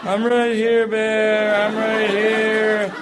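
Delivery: high, slow, loud, laughing sing-song; mock bait talk. Calling out to a bear, laughing sing-song. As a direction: tease the bear like tasty bait.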